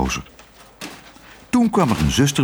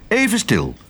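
calling out; 10 semitones higher than usual. A narrator's reading. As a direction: cut in to hush everyone, pitched up. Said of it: "riep meneer Pijpentoon". (uitroepend, met hoge stem)

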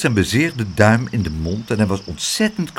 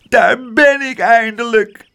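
sobbing; 11 semitones higher, 4 dB louder than usual. A narrator's reading. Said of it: "snikte Spijtebijt".

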